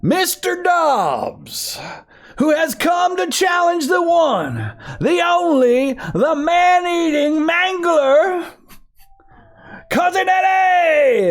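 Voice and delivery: high, slow shouted fight intro; ring announcer. Acted. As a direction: drag out every word to the crowd.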